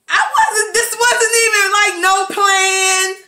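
ordinary delivery

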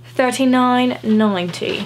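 put-on British accent, slowed down. Haul vlogger tells viewers the sweater's price, put-on British accent. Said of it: "saying all the prices in a British accent".

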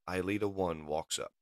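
usual speaking voice

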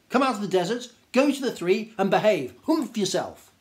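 a grand Djinn lecturing the camel; stern scolding Djinn. Acted. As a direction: tell the lazy camel off.

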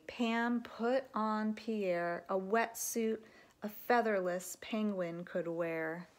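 plain reading voice

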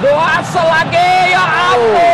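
loud, high, slow plea; exasperated cry. Real, not acted. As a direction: cry out in disbelief. Commentator pleads after chance.

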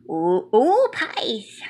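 ordinary delivery, nothing marked

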